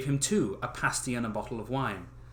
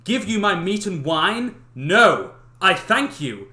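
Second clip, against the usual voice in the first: higher, louder snooty refusal; haughty young man; 6 semitones higher, 10 dB louder than usual. Acted. Clever eldest son refusing to share food. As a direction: refuse him with pompous disdain.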